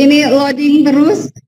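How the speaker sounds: talking through a sheepish laugh. Real, not acted. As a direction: laugh it off, embarrassed. Laughing about a frozen screen.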